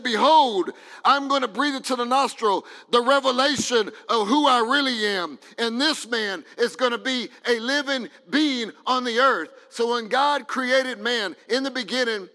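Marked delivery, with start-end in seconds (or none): 0.0-9.5 loud, high proclamation as God; booming voice of God. Acted. Preacher voicing God creating man, loud proclamation. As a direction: declare it like the creator speaking.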